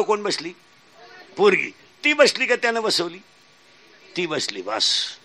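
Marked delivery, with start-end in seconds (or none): 4.2-5.2 slow, low, soft answer. Acted. Preacher answers his own question slowly and low. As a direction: drag it out quietly, low.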